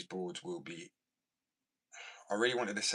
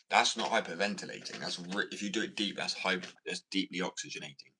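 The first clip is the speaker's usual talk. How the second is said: ordinary talk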